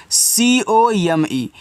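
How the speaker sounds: slow letter-by-letter spelling; each letter drawn out. Shown as a demonstration. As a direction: spell it out slowly for the class. Teacher spells word by letters.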